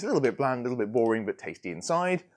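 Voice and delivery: normal talking voice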